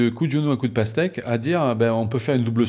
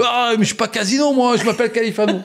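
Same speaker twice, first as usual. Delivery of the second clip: high, loud indignant call back; young player yells back his real name; shouted retort; 13 semitones higher, 5 dB louder than usual. Retold or imitated. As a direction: shout back the correction with a laugh. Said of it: "je dis".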